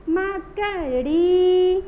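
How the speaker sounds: मकड़ी slowly, with a loud held ड़ी; stressed long ड़ी. Shown as a demonstration. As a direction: break up मकड़ी and stretch the ड़ी. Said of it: "जोर देके बोलना है". (मकड़ी में ड़ी लंबी, जोर देकर)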